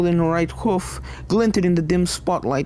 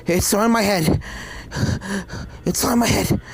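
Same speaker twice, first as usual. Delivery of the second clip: panicked repeating; 3 semitones higher than usual. Acted. Panicked villain.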